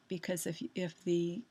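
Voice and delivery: usual speaking voice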